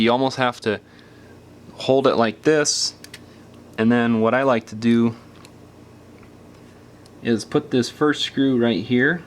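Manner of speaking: ordinary talk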